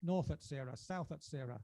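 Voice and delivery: rising and falling spoken-word recital, charged; performance poetry. Acted. Poet reciting his poem to a live crowd, dramatic. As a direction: declaim each line to the audience like a manifesto.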